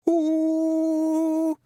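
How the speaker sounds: high, loud drawn-out wail; mournful moan. A narrator's reading. Pixie poet wailing theatrically on the snowdrift. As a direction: moan like a beast in pain. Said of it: "like some animal in terrible pain".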